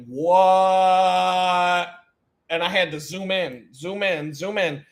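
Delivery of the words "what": drawn-out shocked what. Retold or imitated. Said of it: "and I'm like".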